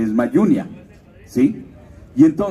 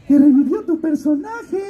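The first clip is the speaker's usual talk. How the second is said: very high godmother caricature. Retold or imitated. Godmother phoning her godson with news, in a very high put-on voice. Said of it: "me dice la madrina".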